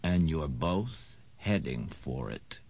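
low, slow, oily menace. A narrator's reading. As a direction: purr it out low and greasy. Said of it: "said an oily voice".

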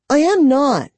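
high, loud flat denial; insist, offended; insistent. A narrator's reading. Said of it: "she insisted".

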